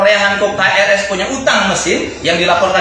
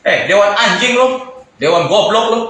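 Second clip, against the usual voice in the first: ordinary delivery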